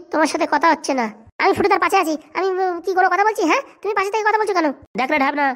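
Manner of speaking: ordinary delivery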